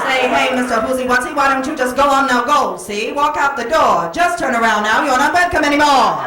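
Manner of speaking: mock-indignant screen dame scolding; 1940s angry movie woman. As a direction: put a fella in his place like a forties heroine. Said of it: "she'd say".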